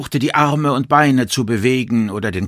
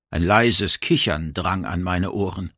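usual speaking voice

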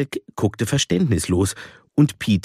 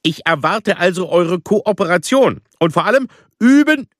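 stern choirmaster calling out, higher and louder; 9 semitones higher, 5 dB louder than usual. A narrator's reading. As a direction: call the room to order sternly. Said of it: "streng über seine Halbbrille schaute und rief".

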